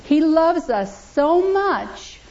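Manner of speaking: slow, high and sincere; tender pulpit emphasis; fervent tenderness. Real, not acted. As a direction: stretch each word with feeling. Preacher stresses God's love.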